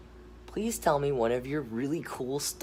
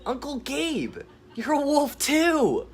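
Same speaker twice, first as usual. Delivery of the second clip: put-on high, louder delighted kid; excited boy voice; 14 semitones higher, 5 dB louder than usual. Nephew telling uncle he is a wolf, high excited. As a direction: tell him with wide-eyed joy. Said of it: "Jeremiah looks over and says".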